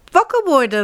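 a little bear calling his parents awake; child bear calling. Acted. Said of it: "roept hij". (kleine beer roept: wakker worden)

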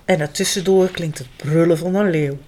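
ordinary delivery, nothing marked

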